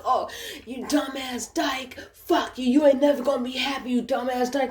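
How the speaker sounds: high, put-on voice of a beaten man; mocking beaten man. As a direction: imitate his helpless cursing from the ground. Imitates beaten man on the ground.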